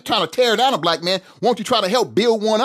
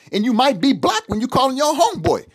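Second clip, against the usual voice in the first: ordinary delivery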